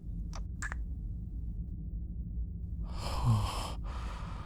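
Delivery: soft, lingering exhale; sigh after a kiss. Acted. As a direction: breathe out slowly, overwhelmed by a kiss. Reacting to a first kiss.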